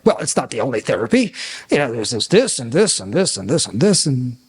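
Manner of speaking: mock know-it-all doctor; showing off. Doctor with bruised ego lists other therapies, showing off. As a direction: reel off a list with see-sawing pitch. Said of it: "wanna show how smart they are".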